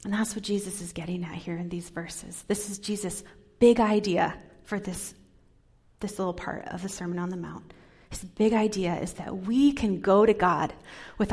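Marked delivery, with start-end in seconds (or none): none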